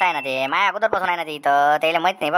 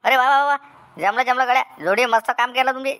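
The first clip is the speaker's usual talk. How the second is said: delighted praise; 7 semitones higher than usual. Acted. Delighted at the feast news.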